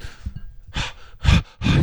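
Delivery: mimicked winded panting; out-of-breath panting. Teller plays the running actor, out of breath. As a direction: puff out heavy voiceless breaths. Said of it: "He's out of breath".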